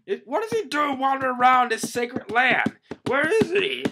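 very high, loud squawk; hammed-up outraged little creature; acted panicked shriek. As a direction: screech in scandalized alarm. Voicing Pappag outraged at Luffy.

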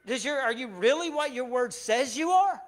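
loud, high, pleading complaint to God; a believer venting anger at God over unanswered prayers, loud; upset at God. Retold or imitated. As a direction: pour out frustration in a rising rant at God. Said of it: "gotten upset at God saying".

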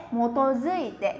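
motozuite said slowly, syllable by syllable; slow syllable reading. Shown as a demonstration. Teacher slowly repeating motozuite for students.

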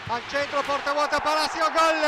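building to a loud goal shout; goal call. Real, not acted. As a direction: build up and explode on gol.

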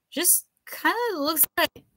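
tipsy and puzzled; drunk professor. A narrator's reading. Drunk professor voiced in story.

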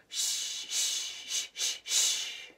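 short puffs of "shh", then longer; breathing pushes. Shown as a demonstration. Teacher leading tummy breathing, rhythmic shh. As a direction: push out rhythmic shushes from the tummy. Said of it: "I'll go first".

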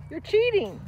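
high mock-scolding pet voice; teasing the dog. Real, not acted.

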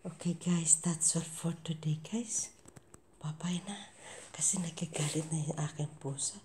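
soft hushed goodbye; whispered sign-off. Real, not acted. Whispering goodbye to viewers near the cat. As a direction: whisper the outro.

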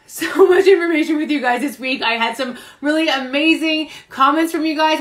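ordinary delivery

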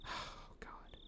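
sighed, barely audible whisper; hushed exclamation. Real, not acted. Player reacts to the worrying story, hushed whisper. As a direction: let it out under your breath. Dread over Rika's safety.

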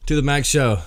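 bright show intro; show host intro. Acted. Opening show greeting.

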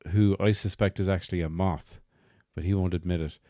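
usual speaking voice

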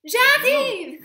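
very high, loud reply; shout. A narrator's reading. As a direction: yell back eagerly. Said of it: "crie Sacha".

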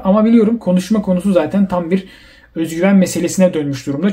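ordinary talk, nothing marked

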